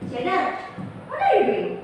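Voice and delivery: high-pitched, needling question; sarcastic dig. Shown as a demonstration. Teacher demonstrates intonation to the class, sarcastic. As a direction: ask it as a jab, voice leaping up.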